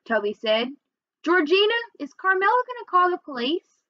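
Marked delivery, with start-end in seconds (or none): 1.2-3.8 high, anxious little brother; mildly alarmed child; worried question. A narrator's reading. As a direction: pitch it up, fretting about trouble.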